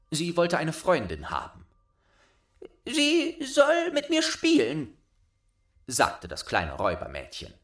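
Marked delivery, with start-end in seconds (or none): 2.8-5.0 put-on much higher, loud child voice; bossy little robber girl. A narrator's reading. Robber girl claiming Gerda, high bossy child voice. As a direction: demand it like a spoiled robber girl.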